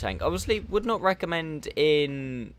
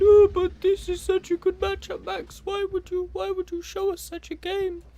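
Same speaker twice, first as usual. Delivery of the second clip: high whiny mock complaint; squeaky imitated viewer; 4 dB louder than usual. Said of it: "before you all start saying".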